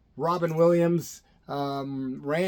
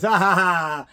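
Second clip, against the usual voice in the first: louder, hammed-up chuckle; imitated laugh; 6 dB louder, slower than usual. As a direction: mimic his burst of laughter. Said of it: "And he goes".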